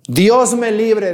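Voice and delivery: pleading cry. Acted. Susana cries out to God.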